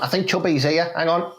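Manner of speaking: ordinary talk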